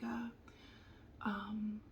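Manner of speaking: ordinary delivery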